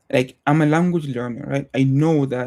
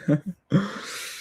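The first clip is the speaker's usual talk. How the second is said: brief, soft, higher-pitched chuckle; short laugh; 5 semitones higher, 4 dB quieter than usual. Real, not acted. Speaker jokes about thoughts that haunt him, short laugh. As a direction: laugh it off lightly.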